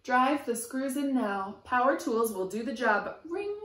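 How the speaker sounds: lively sing-song picture book reading; reading to children. A narrator's reading. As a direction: chant the rhyme to a small child. Reading rhyming picture book aloud.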